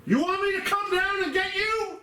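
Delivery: slow menacing shouted threat; angry shout. Acted. Guard threatening spirits, yelling.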